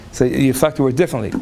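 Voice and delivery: ordinary talk, nothing marked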